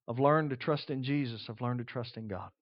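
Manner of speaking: hushed, half-sung lines; quietly echoing an old hymn; soft song quote. Retold or imitated. Preacher softly quoting an old hymn, hushed and tender. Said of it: "There's an old song that says".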